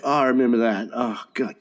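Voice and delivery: ordinary talk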